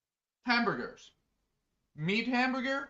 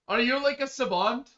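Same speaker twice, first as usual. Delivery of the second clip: ordinary talk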